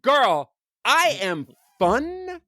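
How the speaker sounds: high, slow, sassy diva insistence; mock indignant boast. Dad defending his dancing to his teen daughter, hammed-up sassy pride. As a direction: draw it out like an offended Broadway star.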